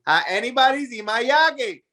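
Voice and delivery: put-on high-pitched question in a thick Spanish accent; Spanish accent, "jacket" said as "yake"; old Spanish accent. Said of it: "I still have the accent".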